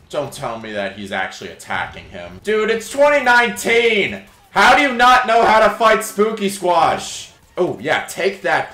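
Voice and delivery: normal talking voice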